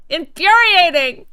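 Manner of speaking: drawn-out, high, loud mock rage; playful exasperated outburst; hammed-up fury. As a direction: overplay the outrage for fun. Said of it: "I'm incensed".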